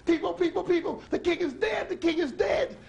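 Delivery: very high, urgent shout; frantic cry. Acted. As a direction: call the crowd in panic. Old lady cries out to crowd.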